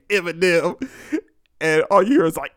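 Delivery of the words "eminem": lightly mimicked answer in an altered voice; skit character's answer. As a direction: give the name as a mock character's reply. Skit character answers the name question.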